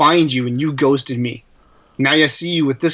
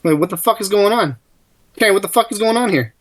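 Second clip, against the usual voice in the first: angry confrontation; 7 semitones higher than usual. Acted. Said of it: "What do you say?".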